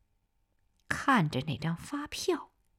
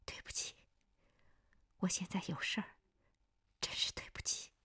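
tearful apology; 11 dB quieter than usual. A narrator's reading. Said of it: "眼泪一下子淌了下来".

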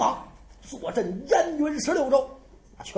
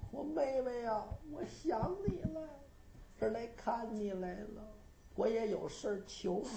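dream brother; 13 dB quieter than usual. Acted. Dead brother speaks in dream.

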